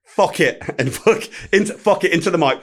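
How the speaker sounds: mock-angry cursing, half amused; retold swearing outburst. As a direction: swear into the mic, imitating a flustered star. Star swearing into the mic.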